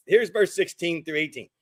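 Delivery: usual speaking voice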